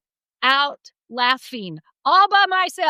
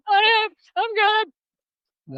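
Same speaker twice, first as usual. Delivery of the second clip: high brave reply; 10 semitones higher, much slower than usual. Retold or imitated. Her high answer to husband.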